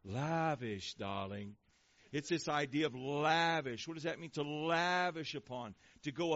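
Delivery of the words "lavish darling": low Hungarian-accented impression, drawn out; playful mock Hungarian accent, dropping low; Zsa Zsa or Eva Gabor, thick Hungarian accent. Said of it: "I can just hear that thick Hungarian accent".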